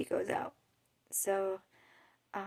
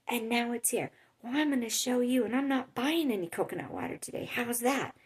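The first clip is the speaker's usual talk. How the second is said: higher, imitated huffy outburst; so angry; 3 semitones higher than usual. Lady refuses to buy coconut water, mock angry. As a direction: deliver a mock-indignant threat before storming off. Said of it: "she just was so angry".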